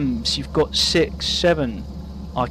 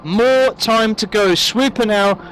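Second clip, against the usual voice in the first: play-by-play commentary; 6 semitones higher than usual. Real, not acted. Live race commentary calling laps.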